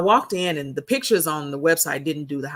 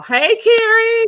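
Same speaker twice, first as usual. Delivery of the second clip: loud, high sing-out greeting; bright-pitched drawn-out call; 8 dB louder, slower than usual. Real, not acted. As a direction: holler hello, stretched way out. Calling out to greet Carrie.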